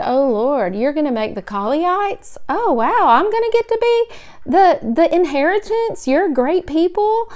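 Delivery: high, gushing and giddy; mock excited gushing. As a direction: play up a giddy wife over the promise. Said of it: "if it had been Cindy Colley, I might have said".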